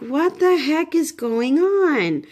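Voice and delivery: retold bewildered outburst, high and drawn-out; high, slow, dramatized disbelief; exasperated disbelief. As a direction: stretch it out slowly in baffled alarm. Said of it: "and I'm like".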